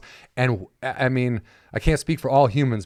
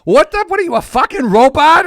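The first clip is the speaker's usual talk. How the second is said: much higher, louder mock outrage; mock yell at TV; 13 semitones higher, 8 dB louder than usual. Yelling at the woman on TV for holding a pose too long, much higher and louder. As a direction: yell at the TV instructor in fake fury. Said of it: "I yelled "Fuck you".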